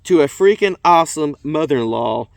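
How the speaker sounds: 大げさに盛り上げて読む; reading shirt aloud. Shown as a demonstration. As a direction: read it out with big enthusiasm, slowing at the end. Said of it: "It says".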